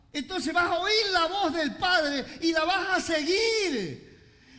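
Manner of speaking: loud, high, fervent preaching with laughter; a pastor crying out that the sheep run to the voice; preaching at full force. Acted. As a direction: shout the climax to the congregation. Preaching climax on the shepherd.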